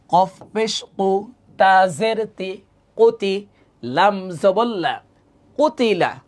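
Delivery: slow, drawn-out letter-by-letter recitation; teacher's measured spelling drill, each syllable held; recited Arabic spelling. Shown as a demonstration. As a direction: sound it out slowly in an even reciting tone. Spells words aloud in recitation.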